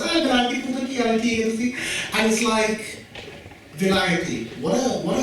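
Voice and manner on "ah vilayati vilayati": cousins' teasing. Retold or imitated. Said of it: "she met her cousins".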